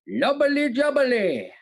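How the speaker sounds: high, slow, drawn-out put-on accent; disguised catchphrase. Host performs favourite catchphrase for quiz, high put-on accent. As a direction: stretch it out high in a silly accent. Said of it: "in the style he did it".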